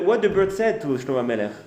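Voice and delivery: ordinary delivery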